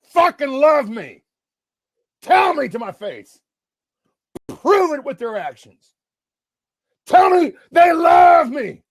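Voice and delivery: high, drawn-out, impassioned shouts; emotional shouting. Real, not acted. Man telling internet haters about loyal friends, real emotional shouting. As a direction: insist on it at the top of your pitch. Overwrought, insisting people love him.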